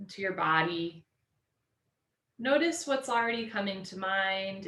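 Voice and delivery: slow, drawn-out yoga teacher cues; unhurried relaxation guide, letting phrase endings stretch; guided meditation. Real, not acted. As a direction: ease them into stillness with long pauses. Leads class into meditation.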